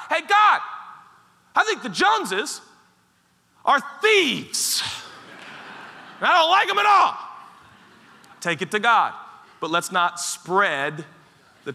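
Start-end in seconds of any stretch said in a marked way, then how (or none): 0.1-7.2 high, loud mock complaint; complaining to God. Pastor voicing a congregant griping to God, loud and high. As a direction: call out your gripe to God, hammed-up.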